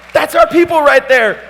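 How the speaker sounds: high, loud mock crowd call; crowd cheer. Answering the crowd's cheer for his wife, shouted high. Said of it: "I'm all".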